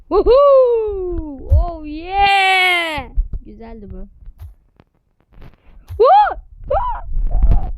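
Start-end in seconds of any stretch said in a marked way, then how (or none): 0.1-3.1 loud, high whooping cheer; real victory whoop; excited cheering. Real, not acted. Celebrating a kill in game. 5.9-7.7 very high, loud whooping scream; real late-night victory scream; screamed cheer. Real, not acted. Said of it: "çığlık attım".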